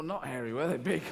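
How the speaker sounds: normal talking voice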